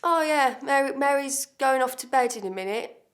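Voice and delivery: a mock domineering brother-in-law laying down orders; imitating the husband's command. The brother-in-law dismissing Mary, a loud bossy order. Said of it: "he's like".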